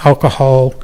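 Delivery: normal talking voice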